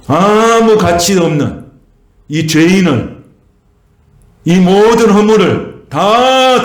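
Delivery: high, slow, impassioned preacher's refrain; fervent preaching. Acted. Pastor preaching the cross to the congregation, impassioned and drawn-out. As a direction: lift the congregation with a rising, drawn-out plea.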